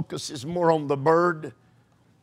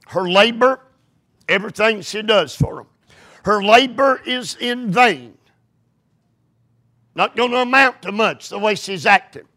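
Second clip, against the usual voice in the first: loud, high fervent preaching; 5 semitones higher, 3 dB louder than usual. Acted. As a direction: preach it hard. Preaching at full force.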